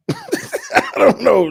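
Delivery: high slow laugh through words. Real, not acted. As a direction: laugh helplessly. Laughing at confusing question.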